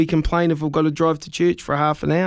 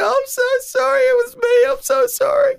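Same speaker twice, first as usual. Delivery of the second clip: high, falsetto sobbing sorry; wailing apology. Retold or imitated. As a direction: blubber the apology in a squeaky voice. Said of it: "And I said".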